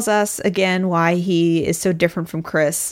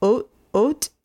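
attempted Canadian "oat" for "out"; Canadian accent; 2 semitones higher than usual. Shown as a demonstration. Podcaster trying out a Canadian accent for listeners, Canadian accent. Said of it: "try and do a Canadian accent".

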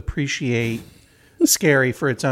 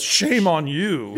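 slow, drawn-out mock scolding; drawling mock reproach; imitated heckler; much slower than usual. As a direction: mimic a stranger's shaming with stretched words. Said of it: "Some guy behind me goes".

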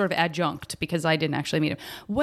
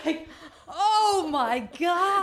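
laughing; 9 semitones higher, much slower than usual. Real, not acted. Reacting to a shocking teacher quote.

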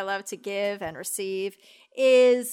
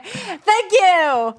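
high, loud, sung-out thank you; hammed-up thanks; 8 semitones higher, 7 dB louder than usual. Thanking a donor on the show, high loud call.

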